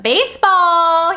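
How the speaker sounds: drawn-out hype call; hyped announcement. Real, not acted. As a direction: announce it like a big event. Hyping the baseball scene.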